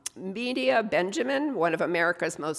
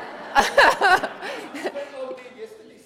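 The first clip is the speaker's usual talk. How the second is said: real laugh; 13 semitones higher, 6 dB louder than usual. Real, not acted. Laughs at her own mix-up.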